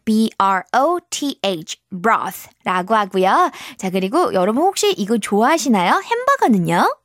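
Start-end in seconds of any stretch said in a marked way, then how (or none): 0.1-3.5 very slow, drawn-out broth; slow teaching pace. Shown as a demonstration. Teacher to kids, slow deliberate broth. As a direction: spell out each sound slowly for children. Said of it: "spelling word for children".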